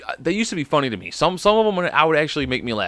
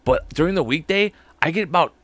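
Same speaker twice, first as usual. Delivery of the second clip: usual speaking voice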